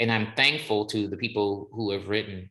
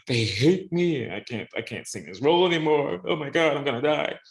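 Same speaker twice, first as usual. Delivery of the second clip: mock panic; 5 semitones higher than usual. Singer retelling his panic over a review, higher mock despair. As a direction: ham up the dread of a bad review. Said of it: "And I thought".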